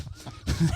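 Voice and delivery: a short breathy laugh. Real, not acted. Laughing at own story.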